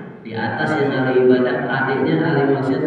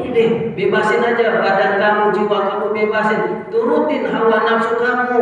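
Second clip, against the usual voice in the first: higher, urging devil voice; tempting devil; 8 semitones higher than usual. Acted. The devil urging the brother to follow desire, higher coaxing voice. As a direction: coax him to let go of restraint.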